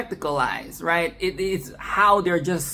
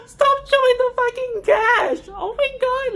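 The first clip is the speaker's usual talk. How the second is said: high, loud shriek through laughter; laughing shriek; 4 dB louder than usual. Real, not acted. Reviewer shrieking with laughter at the gory gash shot. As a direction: scream at the screen while cracking up.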